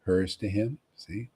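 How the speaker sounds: usual speaking voice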